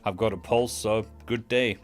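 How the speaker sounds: ordinary talk, nothing marked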